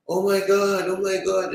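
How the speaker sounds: high, loud mock panic; mild mock yell of panic; retold scream. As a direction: imitate the screaming kids, half-hearted. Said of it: "they are screaming".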